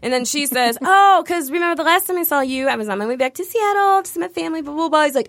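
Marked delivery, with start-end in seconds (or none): 0.8-5.0 high, eager flirty prattle; mock gushing chatter. Passenger reminding pilot of their last meeting, mock gushing. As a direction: imitate her babbling on at him.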